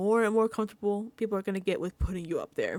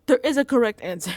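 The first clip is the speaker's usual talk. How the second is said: fast and much louder; loud insistence; 9 dB louder, much faster than usual. Real, not acted. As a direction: blurt it out emphatically.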